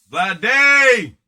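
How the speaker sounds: loud, drawn-out hype call of the name; excited shout. Real, not acted. Host revealing the card after a drum roll, loud hype shout. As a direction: sell the reveal like a ring announcer.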